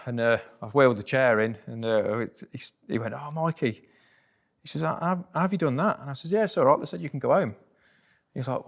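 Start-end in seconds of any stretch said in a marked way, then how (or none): 3.1-6.0 retold amazed exclamation, higher; imitating his astonished disbelief. Father-in-law amazed at son-in-law, mock surprised voice. 6.3-7.5 bright retold cheer, higher and louder; cheerful reassurance. Son-in-law cheering up dying father-in-law, excited voice.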